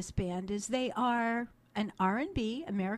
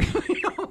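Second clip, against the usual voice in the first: bright laugh; 10 semitones higher, 6 dB louder than usual. Real, not acted. Laugh after joke.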